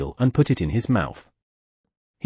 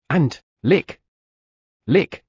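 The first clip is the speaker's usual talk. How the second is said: licking sounds; 5 semitones higher, 3 dB louder, much slower than usual. Acted.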